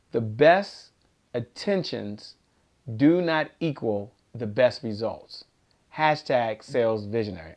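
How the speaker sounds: measured, low-pitched dictation; deliberate and serious; serious statement. Shown as a demonstration. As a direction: deliver a motto to be written down, pausing between phrases. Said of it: "gonna be serious for just a second".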